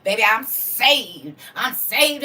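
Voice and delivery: hammed-up smug I'm saved; mock saved churchgoer. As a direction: ham up the smug believer. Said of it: "I say this all the time".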